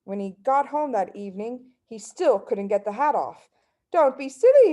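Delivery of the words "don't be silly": higher, louder scolding wife; wife's voice. A narrator's reading. Wife dismissing husband's worry, higher and louder. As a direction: brush off his fuss as the wife. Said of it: "his wife said".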